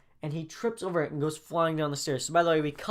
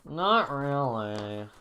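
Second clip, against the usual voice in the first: very slow, dragged-out put-on voice; upset kid; much slower than usual. Reggie answering Miss Walker with a broken arm, upset. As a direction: drag it out miserably. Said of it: "Reggie's kinda, you know, upset".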